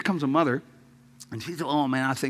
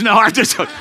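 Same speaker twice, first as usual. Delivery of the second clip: laughing; 9 semitones higher, 12 dB louder, faster than usual. Real, not acted. Laughing at own joke.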